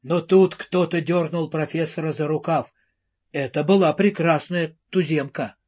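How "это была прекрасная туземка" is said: plain reading voice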